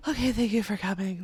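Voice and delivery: wavering, worn-out thanks to guests; mock wilted. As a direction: act drained like a wilted flower. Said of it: "we were like wilted flowers".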